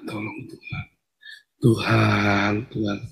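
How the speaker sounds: very soft prayer to God; hushed plea. Acted. Worshipper calling on God for help, hushed plea. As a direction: plead under your breath.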